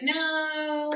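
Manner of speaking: high, drawn-out mock "no"; kid's refusal. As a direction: voice a resistant kid's long, high no. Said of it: "is going to kind of, like, be like".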